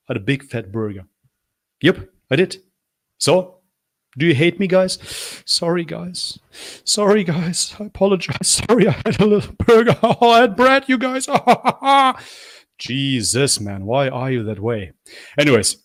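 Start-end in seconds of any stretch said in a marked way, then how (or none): 1.8-8.5 high, hammed-up sorry voice; mock apology. Mocking apology for eating burger. 8.7-12.2 high, fast, giggling mock sorry; laughing mock apology.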